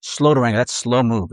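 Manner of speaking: ordinary talk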